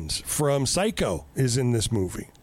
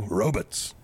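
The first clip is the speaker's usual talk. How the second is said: Bernie Sanders impression; 7 semitones lower than usual. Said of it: "as Bernie Sanders would say".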